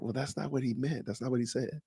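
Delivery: softly voiced imitation of people correcting him; quiet correction. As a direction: hand it back softly, a mild mock correction. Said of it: "and they're like".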